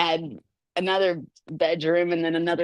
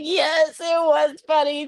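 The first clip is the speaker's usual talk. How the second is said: put-on higher child voice agreeing; a hint of a child, higher; daughter's voice; 10 semitones higher, 3 dB louder, slower than usual. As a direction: lightly voice the little girl. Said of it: "She was like".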